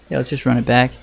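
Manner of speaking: usual speaking voice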